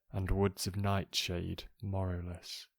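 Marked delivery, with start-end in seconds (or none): none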